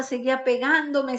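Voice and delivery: ordinary talk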